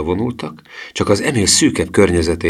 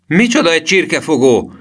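put-on loud, high-pitched boyish outcry; high exclamation of outrage; indignant shout; 12 semitones higher, 6 dB louder than usual. A narrator's reading. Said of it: "kiáltotta Emil".

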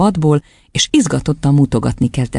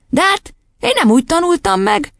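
put-on very high little boy's voice; bewildered; 13 semitones higher than usual. A narrator's reading. Boy telling headmistress he learned differently, bewildered. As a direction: protest in baffled dismay. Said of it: "hökkent meg Vilmos".

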